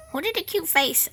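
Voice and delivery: high-pitched pet-doting voice. Real, not acted. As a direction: coo adoringly at a little animal. Cooing to her animal.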